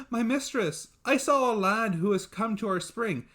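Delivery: put-on high, excited young servant voice; a breathless girl bursting with news; eager servant girl. Servant girl telling her mistress, high and eager.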